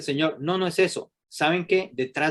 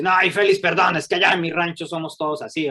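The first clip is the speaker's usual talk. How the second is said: excusing man; 4 semitones higher, 4 dB louder than usual. Retold or imitated. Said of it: "Y dice".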